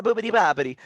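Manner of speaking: high, loud cartoon Italian gibberish; mock Italian. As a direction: bounce it like a cartoon Italian chef. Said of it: "French accents and Italians".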